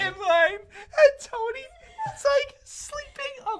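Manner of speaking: high, slow words through laughter; fighting laughter to get the words out; laughing. Real, not acted. Still cracking up while describing the sleeping friend.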